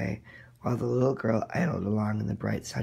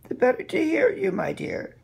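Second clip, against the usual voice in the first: very high, louder fake-granny reply; the disguise starting to crack, rising; wolf playing grandmother; 6 dB louder than usual. Wolf answers the girl's question, very high and louder.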